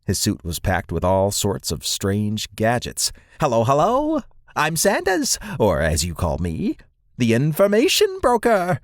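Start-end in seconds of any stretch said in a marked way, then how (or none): 3.4-8.8 squeaky little squirrel creature, very high; high-pitched voice. Acted. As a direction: introduce yourself in a piping, high voice with soaring word endings. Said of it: "he said in a high-pitched voice".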